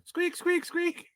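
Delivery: tiny high squeaky critter voice; gopher squeak. Retold or imitated. A vlogger mocking the gopher with a high squeak. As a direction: squeak it like a little rodent.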